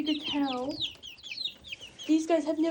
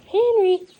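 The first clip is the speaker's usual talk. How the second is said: Henry shouted high and loud; a sudden loud high Henry, full volume; shouting the name; 5 semitones higher, 12 dB louder than usual. Real, not acted. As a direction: call Henry from across the yard. Shouted name after whisper.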